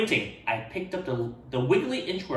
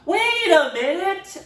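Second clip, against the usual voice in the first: higher, louder, slower; sudden realization; 5 dB louder, slower than usual. Acted. Ralph realizing something happened, high and slow. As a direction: catch the idea mid-thought.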